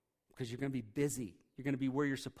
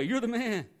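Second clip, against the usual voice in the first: cheering compliment; 8 semitones higher, 7 dB louder than usual. Shown as a demonstration. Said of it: "It's not that way".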